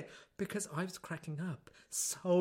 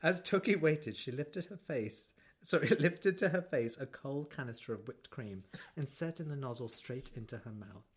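sultry book reading; 7 semitones lower, 7 dB quieter than usual. Acted. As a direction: purr the passage like a steamy novel. Reads erotic book excerpt.

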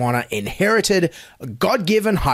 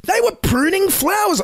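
mock amazement. Mocking the news trivia.

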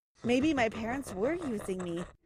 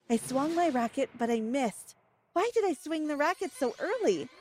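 ordinary delivery

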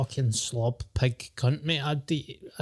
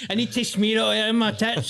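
laughing; 7 semitones higher than usual. Real, not acted. Laughing reply to brown sauce joke.

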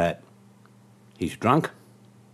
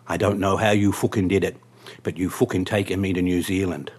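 rough attempt at an Irish accent. Drunk passenger accusing his cab driver, Irish accent. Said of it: "I'll do this in the Irish accent".

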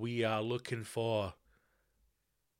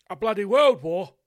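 emphatic; 6 semitones higher, 10 dB louder than usual. Real, not acted. Emphatic remark.

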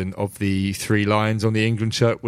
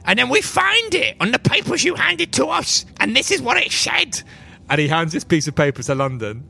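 much higher, serious little gnome voice; gnome spymaster Silas; 14 semitones higher than usual. Acted. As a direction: explain the secret gravely, tiny and earnest. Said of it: "He says".

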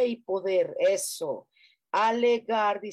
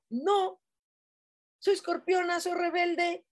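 higher, bratty mock defiance; mock defiant Scorpio; 9 semitones higher than usual. Mocking a viewer who rejects her stone, bratty and defiant. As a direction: play the stubborn Scorpio who rejects advice.

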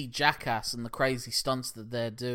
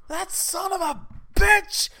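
high, loud, slow outraged yell at the betrayal; furious shout; 6 dB louder, slower than usual. Retold or imitated. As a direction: leap up and bellow in fury, drawing it out. Vince furious on seeing Luger.

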